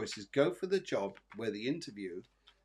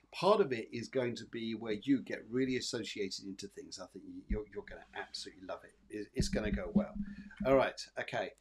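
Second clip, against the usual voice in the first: ordinary delivery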